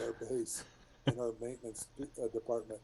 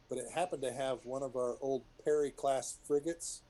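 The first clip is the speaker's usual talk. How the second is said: normal talking voice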